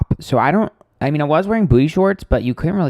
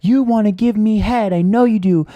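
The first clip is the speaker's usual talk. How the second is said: higher, strained mock scream of a crude heckler; mocking heckler yell; 8 semitones higher than usual. Heckler shouting from his pickup, a mildly mocking high yell. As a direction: imitate the man yelling from his car. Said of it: "starts screaming".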